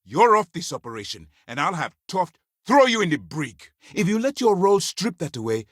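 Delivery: commander's heated ultimatum; angry warning. Acted. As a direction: threaten a subordinate with dismissal. Commander threatens agent with the brig.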